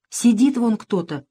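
usual speaking voice

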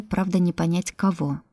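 usual speaking voice

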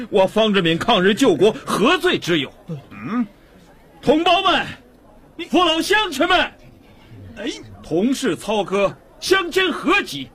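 high-pitched, ringing speech to a crowd; impassioned public oratory. Acted. Prisoner appealing to his countrymen, impassioned oratory. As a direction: proclaim it passionately across the street.

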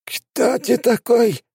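high, slow rasping question; hoarse. A narrator's reading. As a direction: croak it out in terror. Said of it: "прохрипел Биба".

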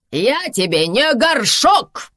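loud, high, drawn-out indignant shout. A narrator's reading. Karina yells at her husband in the salon, shouting. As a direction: yell it slowly, hammering the last word. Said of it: "кричит Карина".

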